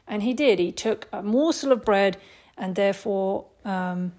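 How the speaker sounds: ordinary talk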